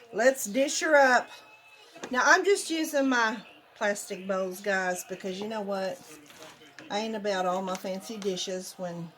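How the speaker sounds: ordinary delivery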